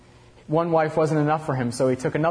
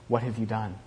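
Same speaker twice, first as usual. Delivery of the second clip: quiet question; 3 semitones lower, 5 dB quieter than usual. Acted.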